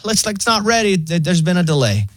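high, laid-back robot assistant; breezy bot with bad news; chill AI voice. Pharmacy AI phone bot reports the delay, high and chill. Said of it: "And so they're like".

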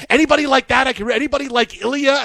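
high, quick imitation of excited fans; book club women. Host imitating wife's book club asking after Ilya, eager high voice. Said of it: "the women in my wife's book club are saying".

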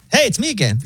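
overly casual AI greeting; chill robot voice. Retold or imitated. Pharmacy AI calling again, high and breezy. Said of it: "it's like".

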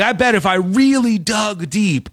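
ordinary talk, nothing marked

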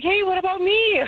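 high-pitched playful protest; mock indignant. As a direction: call for attention, comically put out. Neglected patient calling to medical staff.

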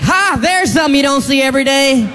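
high, smug quip; mock smug locksmith. Comedian voicing the locksmith seeing a Korean man with keys, high smug. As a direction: gloat at the passerby. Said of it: "he's like".

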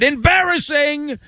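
high-pitched mock heckle; retold shout. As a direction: imitate the heckler's cry by pitch, not volume. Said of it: "who shouted".

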